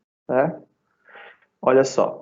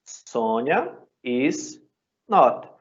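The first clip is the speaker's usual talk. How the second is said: slow dictation; much slower than usual. Shown as a demonstration. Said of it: "teacher demonstrating the negative".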